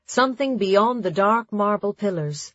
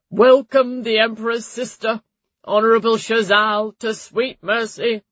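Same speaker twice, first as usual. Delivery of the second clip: loud, raised ceremonial monotone; droning high priest; 4 semitones higher, 6 dB louder than usual. A narrator's reading. High Priest introducing the Emperor's sister, droning loudly. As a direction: drone out a formal introduction to the assembly. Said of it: "the High Priest droned out his introduction".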